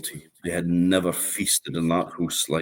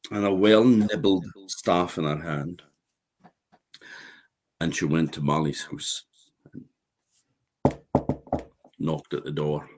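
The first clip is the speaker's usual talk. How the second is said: ordinary delivery, nothing marked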